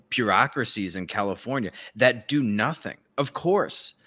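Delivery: usual speaking voice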